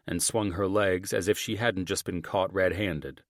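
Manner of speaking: plain reading voice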